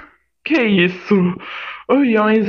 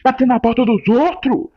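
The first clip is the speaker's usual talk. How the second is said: higher, louder furious rant at the door; angry yelling; 7 semitones higher, 3 dB louder than usual. Acted. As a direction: yell at whoever keeps knocking. Gritando com quem bate à porta. (gritando furioso com quem bate na porta)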